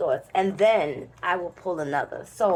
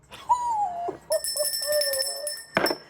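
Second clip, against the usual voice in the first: high laughter. Real, not acted. Laughing at clove mistake.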